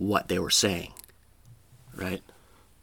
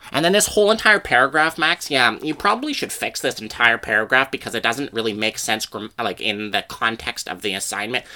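fussy corrector. Retold or imitated. Front-row classmate critiques the paper.

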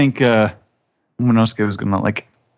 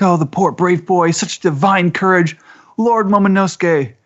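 mock weepy awe; 8 semitones higher than usual. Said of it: "they're crying".

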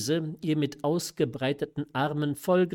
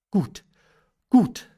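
abrupt, high, loud agreement; suppressed anxiety; 6 semitones higher, 8 dB louder than usual. A narrator's reading. Mother humouring her daughter, suppressed anxiety. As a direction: agree briskly to cover mild worry. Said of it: "die ihre Angst unterdrückte".

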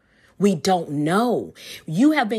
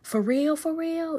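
amazed disbelief; 4 semitones higher, 4 dB quieter than usual. Retold or imitated. Preacher acting out her own amazement to the congregation, rising incredulous. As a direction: voice climbing higher in delighted disbelief. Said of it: "I'm laughing because that is so deep".

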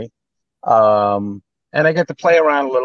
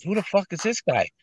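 scared husband; 7 semitones higher, 6 dB quieter than usual. Retold or imitated. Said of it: "He goes".

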